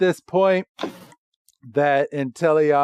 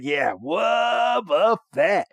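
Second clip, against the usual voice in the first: high, drawn-out cartoon creature cry; Wobbuffet; 5 semitones higher, much slower than usual. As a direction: imitate a goofy creature's catchphrase. Imitates Wobbuffet's catchphrase.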